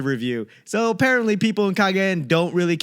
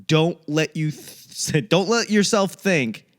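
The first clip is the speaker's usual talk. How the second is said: ordinary delivery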